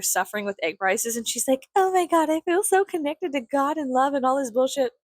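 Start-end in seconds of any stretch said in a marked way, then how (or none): 1.8-4.9 mocking high-pitched gushing celebrity. Mimicking a celebrity after her space flight, mock gushing. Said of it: "everybody's dogging on [name]".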